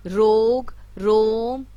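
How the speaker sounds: रोग and रोम with a clear, held ओ; o vowel demo. Shown as a demonstration. Teacher showing the ओ sound. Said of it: "इसमें ओ का sound आता है".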